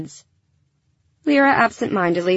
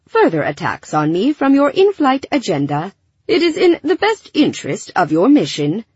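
prim, formal android with higher, swooping pitch; polite robot; 5 semitones higher, 3 dB louder than usual. A narrator's reading. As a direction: state it like a courteous machine. Narrator voices Alfie the robot.

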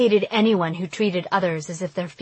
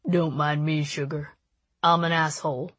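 lower, mock-apologetic cocky guy; lightly voiced cocky boy, mock sorry; West; 3 semitones lower than usual. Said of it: "as if he were apologizing".

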